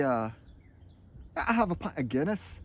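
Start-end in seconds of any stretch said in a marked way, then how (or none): 1.4-2.6 higher, lilting Irish; playful mock Irish; Irish accent. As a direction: order a drink in an Irish accent. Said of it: "not a bad Irish accent".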